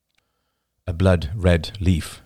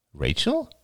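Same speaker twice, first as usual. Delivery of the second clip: surprised; 7 semitones higher, 4 dB quieter than usual. A narrator's reading. A voice calls her.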